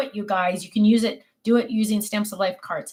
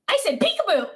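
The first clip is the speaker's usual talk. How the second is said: put-on toddler insisting; 9 semitones higher than usual. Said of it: "And he said".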